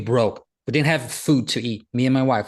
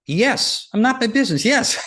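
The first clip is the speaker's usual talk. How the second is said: excited realization; 7 semitones higher than usual. Retold or imitated. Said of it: "I'm like".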